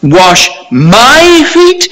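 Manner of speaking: "my" stretched out, high and loud; slow, astonished emphasis on "my"; stress on MY. Shown as a demonstration. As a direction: hit "my" hard and let the question rise. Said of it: "Emphasis again upon thou and my".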